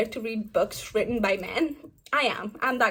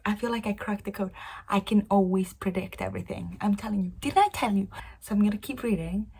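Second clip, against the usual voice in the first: hushed. Real, not acted. Reader confiding a theory while housemate sleeps, hushed. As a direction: keep it down, nearly whispering. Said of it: "I need to be quiet".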